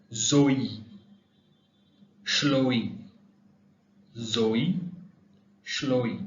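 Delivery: Zoe and Chloe said clearly with the vowel split; French example names. Shown as a demonstration. Teacher reads out the example names. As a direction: model each name, one at a time. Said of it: "For example".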